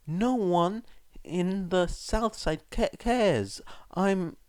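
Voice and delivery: put-on high, slow, halting boy's voice; trailing off. A narrator's reading. Son explaining then losing his words, trailing off. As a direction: let it fade unfinished. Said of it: "he trailed off again".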